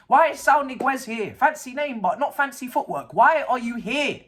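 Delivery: ordinary talk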